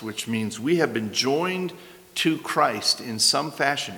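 ordinary talk

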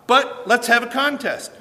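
a hint of a bold prophet calling out his rivals; Elijah challenging. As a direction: throw down the dare to the prophets of Baal. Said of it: "and Elijah's saying".

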